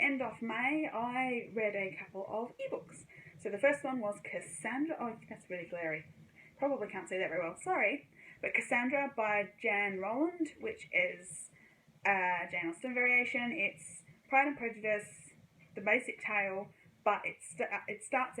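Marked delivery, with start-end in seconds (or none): none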